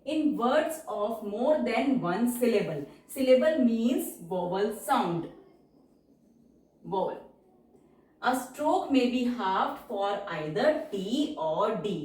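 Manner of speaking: very slow, evenly spaced English words; slow dictation. Shown as a demonstration. Teacher reads the halving rule slowly for the class.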